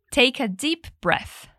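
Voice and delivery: slow, clear repetition model. Shown as a demonstration. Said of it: "One more time". (devagar, repetindo para o aluno)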